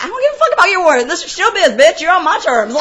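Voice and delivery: high, loud, rapid-fire retort; defiant outburst. Retold or imitated. Producer overruling cop's warrant backstage, defiant. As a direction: lay down the law in mock fury. Retold argument with police.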